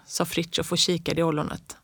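ordinary delivery

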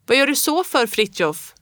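high, loud call to the roof. A narrator's reading. Boy calls up to Fritjof on the roof, loud. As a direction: shout up at Fritjof. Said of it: "ropade jag".

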